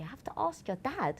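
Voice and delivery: high disbelieving question; mock your partner's stunned disbelief; incredulous. Husband retelling how she asked about his dad, incredulous.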